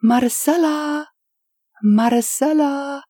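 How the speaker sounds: high calling shout across the street; long, high-pitched call of her name. A narrator's reading. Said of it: "she starts shouting".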